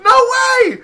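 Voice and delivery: very high, loud mock squeal; hammed-up shout of astonishment; amazed shriek. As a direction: imitate a partner shrieking in disbelief. Voicing her amazed reaction.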